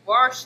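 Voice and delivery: "wash" said as "warsh"; homely "warsh" for "wash". Real, not acted. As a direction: put an R before the sh in "wash". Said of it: "there's an R in wash".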